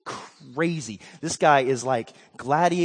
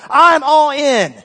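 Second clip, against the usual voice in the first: louder and slow, every word planted; emphatic declaration; 8 semitones higher, 10 dB louder, slower than usual. Retold or imitated. Abishai declaring his position, loud and slow. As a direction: declare it with full commitment. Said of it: "He's saying".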